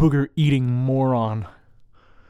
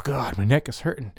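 ordinary talk, nothing marked

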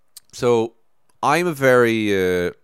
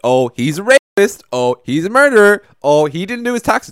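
mock scandal cries; 10 semitones higher, 9 dB louder than usual. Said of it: "Every single person".

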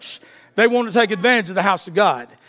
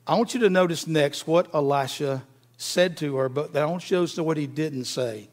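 ordinary delivery

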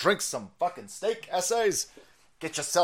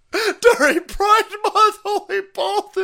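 much higher, laughing through the words; laughing mock voice; 8 dB louder, slower than usual. Streamer still in high mock voice, laughing. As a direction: giggle while staying in the squeaky voice.

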